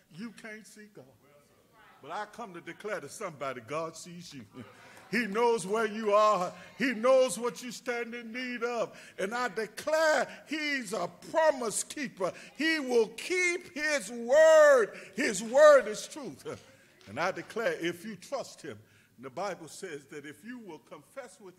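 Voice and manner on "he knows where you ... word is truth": high, loud, slowing shout of promise; preacher hammering home God's promise; preaching at full force. Acted. Preacher to the congregation, shouting at full force.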